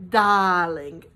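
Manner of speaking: loud, drawn-out British "dahling"; Lisa Vanderpump. Retold or imitated. Vlogger announcing her third lesson, imitated British darling. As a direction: imitate a grand British socialite. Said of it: "I used to love it when she'd say".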